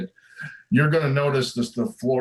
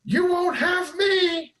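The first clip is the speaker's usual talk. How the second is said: rising, ever higher panicked cries; terrified scream; slower than usual. Acted. GM voicing the trapped woman screaming at the attacker, rising panic. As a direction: climb higher with each plea, terrified. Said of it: "She screams".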